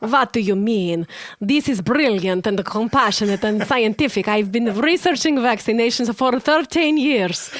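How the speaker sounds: loud, hammed-up Russian accent; emphatic mock-Russian, W turned to V. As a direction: read it out as a thick comic Russian. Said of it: "this kind of Russian accent".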